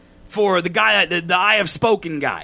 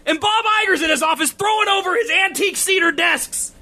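shouting, much higher and building in fury; angry shouting rant; 11 semitones higher than usual. Real, not acted. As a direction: rant at full volume, pitch climbing. Furious rant about Disney.

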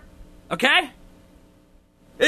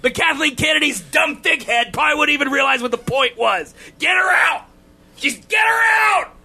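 shouting, much higher and building in fury; angry shouting rant; 11 semitones higher than usual. Real, not acted. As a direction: rant at full volume, pitch climbing. Furious rant about Disney.

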